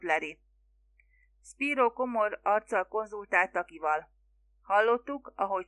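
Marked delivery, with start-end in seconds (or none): none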